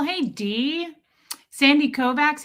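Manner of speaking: normal talking voice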